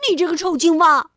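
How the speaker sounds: loud, fast shouted insult; angry retort. Acted. Rabbit shouting back at the angry frog, loud retort. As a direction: fire the insult right back.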